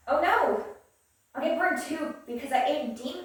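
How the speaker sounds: high, loud panicked exclaiming; acted alarm. Reader voicing a character about to get burned, alarmed. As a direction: cry out in hammed-up dismay.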